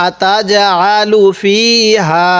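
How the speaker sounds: আতাজআলু ফিহা read slowly, with ফি and হা each held for one count; one-alif elongation. Shown as a demonstration. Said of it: "ফি এর মধ্যে এক আলিফ টান দিব".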